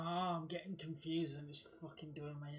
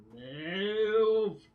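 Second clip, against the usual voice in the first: sudden high, loud bark; angry shout; 7 semitones higher, 16 dB louder than usual. Real, not acted. Gamer yelling at the character to move, angry. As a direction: yell it to force it to move.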